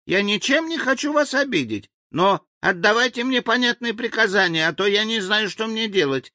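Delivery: high-pitched pleading figure; meek, bewildered native. A narrator's reading. Frightened native begs for clear orders, meek and high. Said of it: "тихо молвил он и покорно".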